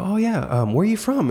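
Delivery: retelling how he greeted a customer, chirpy and high; friendly shop greeting. Retold or imitated. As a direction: put on a chirpy salesman's lilt. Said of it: "I go".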